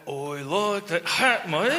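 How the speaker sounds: slow, lazy mock Aussie drawl; laid-back Australian. Shown as a demonstration. Host asked to sound laid back, slow mock Aussie. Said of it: "say that you like my hat in a laid back fashion".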